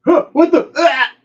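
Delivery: high, loud panicked cry; falling scream. Acted. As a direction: shriek as you tumble off. Enemy falling with grenade.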